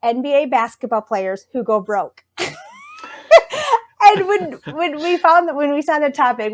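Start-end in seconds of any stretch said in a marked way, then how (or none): 4.0-6.5 higher, real laughter running into the words; laughing while talking. Real, not acted. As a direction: start the story through a laugh. Laughing at the students' topic.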